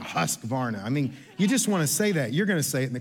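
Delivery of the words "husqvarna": Husqvarna said low, like a machine's growl; string trimmer sound. Shown as a demonstration. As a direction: play the trimmer saying Husqvarna, pitched low. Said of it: "sounds like a string trimmer that would".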